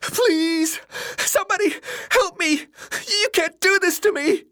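high, crying plea for help; rising desperation. A narrator's reading. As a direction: beg wildly for your life. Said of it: "his voice rising in desperation".

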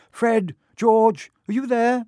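high, loud motherly call. A narrator's reading. Mum calls her sons from the platform, high and loud. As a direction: call them in from outside.

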